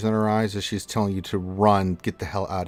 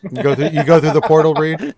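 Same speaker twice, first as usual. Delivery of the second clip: hurried call; 7 semitones higher, 9 dB louder than usual. Real, not acted. GM hurrying the players along.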